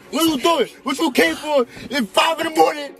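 high-pitched, exasperated pleading; indignant protest. Real, not acted. Man arguing with passenger over the late visit, indignant protest.

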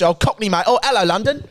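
Cockney send-up rising in pitch; hammed-up London accent; mock Cockney. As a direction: imitate a chirpy Cockney greeting. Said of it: "it sounds like you just".

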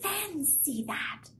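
put-on high, soft old lady marvel; quietly wondering old woman; sweet old lady.

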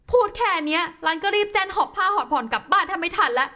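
ordinary talk

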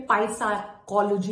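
"पैसाकोलॉजी" with the P sounded; mock the mistake "पैसाकोलॉजी"; wrong pronunciation. Shown as a demonstration. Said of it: "mispronounce कर देते हैं". ("पैसाकोलॉजी" गलत उच्चारण)

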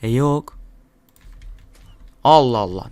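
usual speaking voice

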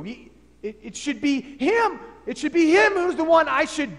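jab back at Paul; loud protest. Acted.

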